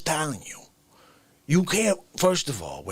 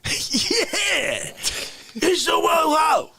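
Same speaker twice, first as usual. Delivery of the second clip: dazed fan cheer; 11 semitones higher, much slower than usual. Retold or imitated. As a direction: cheer on while knocked senseless. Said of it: "you're still like".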